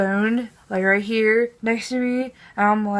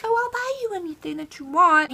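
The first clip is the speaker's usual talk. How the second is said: high, put-on generous voice; mock nice guy; 8 semitones higher, 3 dB quieter than usual. Mocking driver suddenly offering to buy anything, put-on niceness. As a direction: mimic his sudden niceness in a higher voice. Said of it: "now he's all like".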